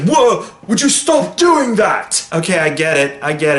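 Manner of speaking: imitating a rattled Thor complaining; hammed-up annoyed whoa; exasperated Thor. Thor annoyed at Doctor Strange.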